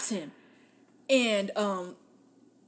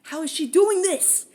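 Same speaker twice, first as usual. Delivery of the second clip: mock shocked; 7 semitones higher, 9 dB louder than usual. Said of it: "everyone's like".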